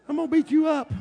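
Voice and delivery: high, put-on Mike Tyson voice; Mike Tyson impression. Preacher mimics Mike Tyson threatening Goliath's foe. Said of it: "I hope Goliath wasn't like Mike Tyson".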